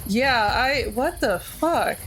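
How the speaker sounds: ordinary delivery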